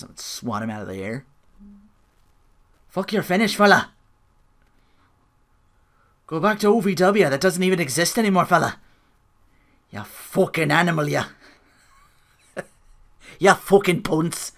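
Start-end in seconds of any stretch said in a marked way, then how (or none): 2.9-11.3 rough imitation of an Irish accent; wrestling fan mocking Sheamus in an Irish accent. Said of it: "careful when I do accents". 13.4-14.5 slow, deliberate mock Irish accent. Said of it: "careful when I do accents".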